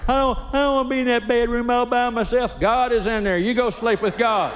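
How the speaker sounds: put-on high pleading kid. Son begging dad not to sleep alone, high and whiny.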